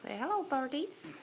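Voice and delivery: high, coaxing sing-song for the birds; sing-song pet talk. Real, not acted. Owner coaxing her birds to greet the camera, high sing-song. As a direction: coo it up high to the birdies.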